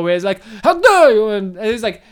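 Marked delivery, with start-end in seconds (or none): 0.6-1.3 high, loud mock outrage; hammed-up indignant outburst; put-on character voice. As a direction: imitate an indignant character, pitched way up. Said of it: "He sounds basically like how I did it before".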